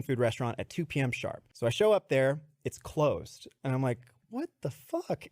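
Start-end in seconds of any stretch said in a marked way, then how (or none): 4.3-5.3 baffled outburst. Retold or imitated. Finding the restaurant closed.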